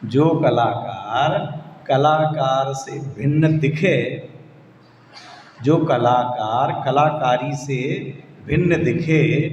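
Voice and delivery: put-on slow, word by word, for the children to follow; slow repeat. Shown as a demonstration. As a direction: lay each word out slowly so they can repeat it. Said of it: "फिर से बोलता हूँ". (धीरे-धीरे, एक-एक शब्द साफ़ बोलकर)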